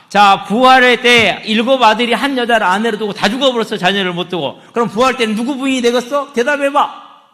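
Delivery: Sadducees daring Jesus to answer, loud and taunting; taunting challenge. Acted.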